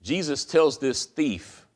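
ordinary delivery

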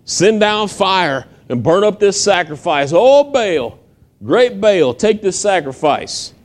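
high, drawn-out cry to Baal; mock hollering prophets. Prophets of Baal crying out to their god, high hollering. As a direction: call out like a desperate prophet begging his god.

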